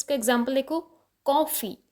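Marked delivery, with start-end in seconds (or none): 1.3-1.8 coffee with weight on कॉ, then falling; coffee, first syllable stressed. Shown as a demonstration. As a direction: lean on the कॉ in coffee. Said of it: "first syllable पे".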